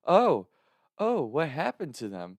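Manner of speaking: imitated worry, slowing down; concerned grandma. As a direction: mimic grandma growing concerned. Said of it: "get real concerned in the phone".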